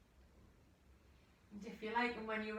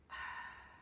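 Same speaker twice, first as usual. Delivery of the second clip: long breathy sigh out through the mouth; audible exhale sigh; 7 dB quieter than usual. Shown as a demonstration. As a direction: let the breath go in a slow sigh. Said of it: "Exhale for six".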